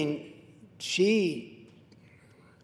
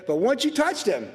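normal talking voice

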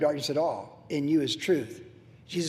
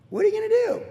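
rhetorical shrug; 14 semitones higher, faster than usual. Real, not acted. Rhetorical question in sermon.

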